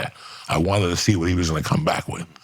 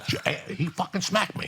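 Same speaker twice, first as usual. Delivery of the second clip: high put-on voice, softer; mock whiny victim; 12 semitones higher, 6 dB quieter than usual. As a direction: lightly play the guy who got smacked. Imitating Steve's fake complaint.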